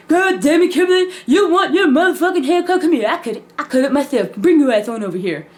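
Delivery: mock furious dad yelling, high and fast; infuriated dad. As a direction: explode like a fed-up father. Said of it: "this infuriated Dad".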